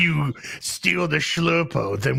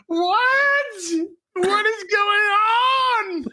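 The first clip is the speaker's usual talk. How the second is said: very high, drawn-out cry of disbelief; slow, high, laughing wail of confusion; real giggling disbelief, stretched out; bewildered shriek; much slower than usual. Real, not acted.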